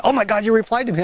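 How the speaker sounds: retold delighted surprise, voice lifted; mock amazement. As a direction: gush lightly like an astonished commenter.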